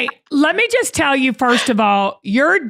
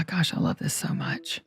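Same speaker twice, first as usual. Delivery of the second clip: soft excited whisper aside; 8 semitones lower, 12 dB quieter than usual. Real, not acted. Host whispers while the call rings, hushed. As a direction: whisper it so the caller can't hear.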